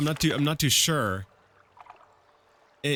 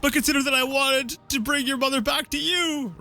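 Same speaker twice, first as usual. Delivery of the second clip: pleading lover; 12 semitones higher than usual. Acted. Lovesick mage apologising to Betaniki, hammed-up high plea. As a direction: beg her forgiveness, overplayed.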